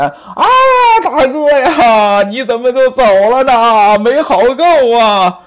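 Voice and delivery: loud, slow fake mourning wail; hammed-up wailing. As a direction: wail for the dead brother with no real grief. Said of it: "到那儿一捂脸".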